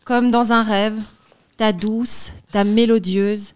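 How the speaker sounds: reading a love letter with flair; dramatic letter reading. Acted. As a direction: perform the letter like a declaration. Reads a love letter aloud.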